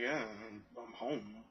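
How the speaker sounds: deflated. Retold or imitated. Glum return home.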